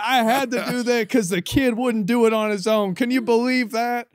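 loud, high put-on voice of an offended old man; imitating his dad. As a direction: play the indignant father ranting on the phone. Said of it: "Is that his accent too?".

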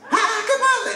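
husband imitating his hysterical wife. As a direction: play the wife losing it, high and frantic. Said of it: "bien histérica".

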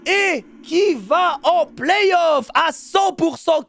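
booming triumphant shout; victory announcement. Real, not acted. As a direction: announce the win like a stadium host. Announces qualification win.

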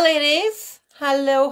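ordinary delivery

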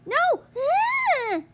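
high loud shout then cry; despairing scream. Real, not acted. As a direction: scream as you lose. Sudden loss in game.

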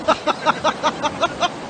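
laughter. Real, not acted. Laughing after the catch.

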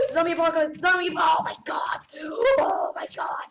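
loud, frantic yelling at the horde; real panicked shouting at a swarm of zombies. Real, not acted.

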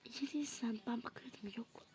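soft unvoiced whisper; whispered words off the scene. Real, not acted. Whispered aside.

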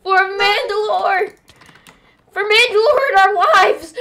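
loud high war cry while fleeing; hammed-up battle cry. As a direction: shout it like a charging warrior.

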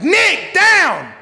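sharp, high, loud command shout; urgent yell at the running dog. Retold or imitated. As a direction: bark the command to stop the dog. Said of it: "I yelled at our dog".

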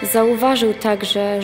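ordinary talk, nothing marked

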